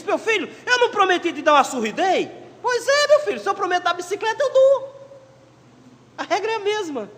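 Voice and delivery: a dad settling it for his son; father's retort. Acted. Father telling his son a promise is kept, high and insistent.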